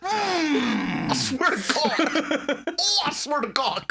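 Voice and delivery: high, loud, drawn-out oath; hammed-up fuming through laughter; mock exasperation. Imitates furious parent fuming.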